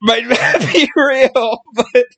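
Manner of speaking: high, slow, tearful giggling; crying with laughter. Real, not acted. Podcaster admitting a fact is made up, crying with laughter. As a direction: stretch it out through helpless laughter.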